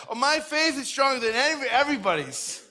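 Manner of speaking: mock bragging, starting high; overzealous boasting. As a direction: brag like a keen new believer. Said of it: "sometimes we're like that".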